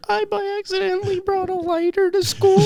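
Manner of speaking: very high, odd put-on kid voice; imitating Sergio. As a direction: squeak it out like a goofy kid. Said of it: "He was like".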